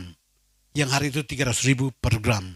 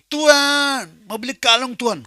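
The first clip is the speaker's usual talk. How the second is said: higher, louder begging prayer to God; louder pleading prayer; 10 semitones higher, 7 dB louder, slower than usual. Retold or imitated. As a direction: cry out the request, pushing harder. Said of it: "Berdoa lagi". (doa memohon lebih keras dan tinggi)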